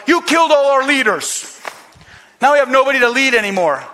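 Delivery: loud, high, accusing the leaders; accusing shout. Retold or imitated. Israel blaming Moses and Aaron, loud accusing cry. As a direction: hurl the blame at Moses.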